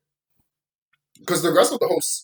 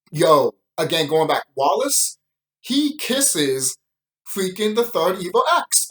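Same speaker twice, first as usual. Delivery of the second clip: ordinary delivery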